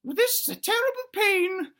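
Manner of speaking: high falsetto dino musing darkly; tiny dinosaur pondering in a very high voice; little dinosaur thinking aloud. Acted. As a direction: squeak out a dramatic soliloquy. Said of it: "now he's thinking and speaking at the same time".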